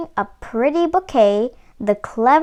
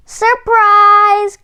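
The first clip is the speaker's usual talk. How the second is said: loud, much higher triumphant shout; spring out and shout it; hammed-up wolf yelling surprise; wolf's cry; 10 semitones higher, 7 dB louder than usual. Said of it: "cried the wolf".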